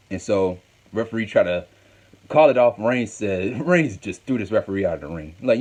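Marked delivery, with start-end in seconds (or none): none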